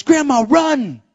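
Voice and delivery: loud, high, drawn-out call; excited child calling out. Retold or imitated. Granddaughter urging her grandma to run, excited call. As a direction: urge her on like an eager granddaughter.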